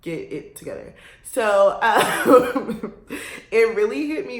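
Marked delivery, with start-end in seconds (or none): 0.0-1.0 a mom cutting through her daughter's drama; stern mom voice. Retold or imitated. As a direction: lower and softer no-nonsense mother. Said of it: "she was like".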